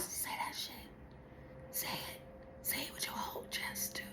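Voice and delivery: breathy urging whisper. Real, not acted. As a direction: whisper it like a secret push. Urging viewers to say it.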